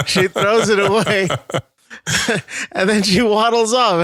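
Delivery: high, laughing through the words; telling it while cracking up. Real, not acted. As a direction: giggle it out.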